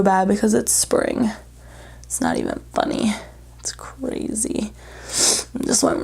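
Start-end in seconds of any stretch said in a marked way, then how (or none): 2.0-6.0 soft breathy aside; whispering. Real, not acted. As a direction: whisper it to yourself. Aside about allergies.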